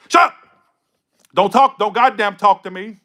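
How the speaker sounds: loud, high outburst; exasperated shout. Real, not acted. Reader silencing a deluded client, exasperated shout. Cuts off imagined client's excuses.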